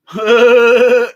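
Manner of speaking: long, high cackling laugh; loud high laugh. Real, not acted. Storyteller cracks up at own tall tale, loud high laugh. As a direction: burst out in a loud sustained laugh.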